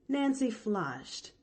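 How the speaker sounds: ordinary delivery, nothing marked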